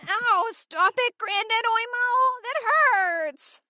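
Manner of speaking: put-on high, pained child begging granddad to stop; hurt child. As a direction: plead like a kid who is being hurt.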